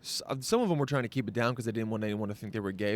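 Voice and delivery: ordinary talk, nothing marked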